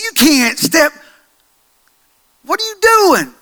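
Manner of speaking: high, loud, incredulous friend yelling at Peter; mocking buddy. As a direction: scold him like he's lost his mind. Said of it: "What are you saying to your buddy".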